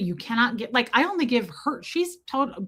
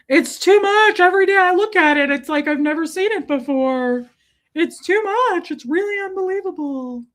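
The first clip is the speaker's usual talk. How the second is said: high, overwhelmed gushing; hammed-up lovesick adoration; Pepe Le Pew; 8 semitones higher, 6 dB louder than usual. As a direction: swoon loudly, pitch way up, then ease off the volume. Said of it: "I get weird like Pepe Le Pew".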